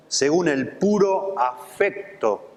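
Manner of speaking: slow, stress on puro; slow emphasis. Acted. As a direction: linger on puro afecto. Slows to stress key phrase.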